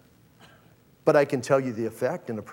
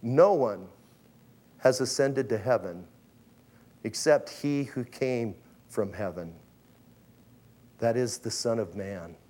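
solemn Jesus; 5 semitones lower than usual. Acted. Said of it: "And he goes".

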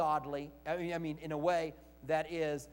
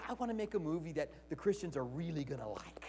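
whispered, much softer sarcastic aside; mock whisper; 2 semitones lower, 7 dB quieter than usual. Preacher imagines Hollywood caring about Christians, whispered mockingly. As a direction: breathe it as a sly aside. Voicing movie makers sarcastically.

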